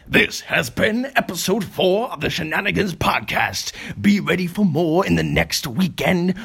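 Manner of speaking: announcer outro. Acted. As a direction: hype it like a show host.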